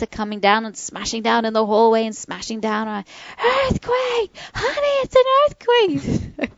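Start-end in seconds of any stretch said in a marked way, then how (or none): 3.4-6.5 high, slow alarmed cry to her husband; panicked call. Retold or imitated. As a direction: shout to him in panic. Calling husband during earthquake.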